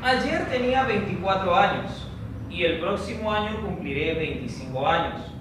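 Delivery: normal talking voice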